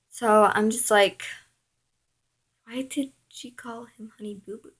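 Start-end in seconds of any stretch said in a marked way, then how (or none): 2.6-4.7 quick, hushed whisper; whispered aside. Retold or imitated. Girl questions the rival's pet name under her breath, whispered. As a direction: whisper it fast, as if to yourself.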